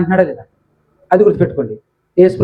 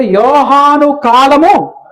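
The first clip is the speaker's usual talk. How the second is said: drawn-out, raised recitation; slow scripture reading; 4 semitones higher, much slower than usual. Shown as a demonstration. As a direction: stretch each word as if reading aloud to a class. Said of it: "ఇక్కడ ఏమంటున్నాడు?". (నెమ్మదిగా వచనం చదవడం)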